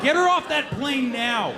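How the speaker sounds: mock-frantic order; mock panic. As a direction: demand it like an alarm. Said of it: "panicked reaction".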